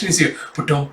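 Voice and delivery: plain reading voice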